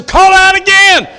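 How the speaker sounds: first of two rising calls, loud and high. Real, not acted. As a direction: fling the call out to the room. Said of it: "preaching climax repeat".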